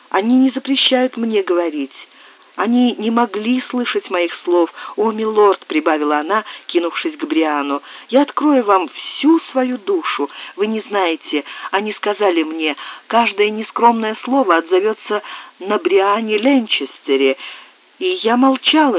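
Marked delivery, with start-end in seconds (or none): 0.1-5.7 put-on higher agitated girl's voice; young woman Susanna. Susanna confiding in the lord, higher pleading voice. 8.1-19.0 put-on higher agitated girl's voice; young woman Susanna. Susanna confiding in the lord, higher pleading voice.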